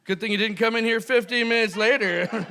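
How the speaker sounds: ordinary talk, nothing marked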